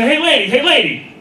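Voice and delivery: calling out. Retold or imitated. Confronting a woman over his wife.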